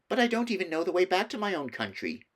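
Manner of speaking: put-on high, pleading child voice; begging to be taken home; pleading Edmund; pleading boy. A narrator's reading. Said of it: "pleaded Edmund".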